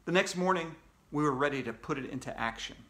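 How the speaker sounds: ordinary delivery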